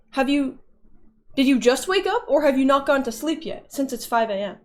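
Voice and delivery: ordinary talk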